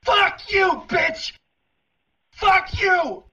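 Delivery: high raging curses; furious screaming. Acted. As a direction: scream in helpless rage. Screaming at the operator.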